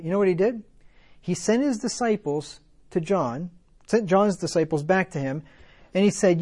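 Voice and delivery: ordinary delivery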